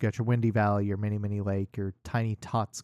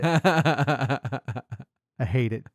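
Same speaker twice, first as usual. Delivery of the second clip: laughing; much slower than usual. Real, not acted. Laughing at hated character name.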